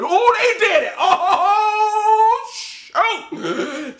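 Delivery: shocked excitement. Retold or imitated. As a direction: yelp in disbelief that they actually did it. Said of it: "They go".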